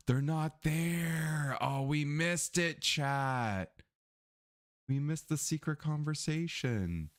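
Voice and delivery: dismayed wail. Real, not acted. Dismayed at missing a secret conversation.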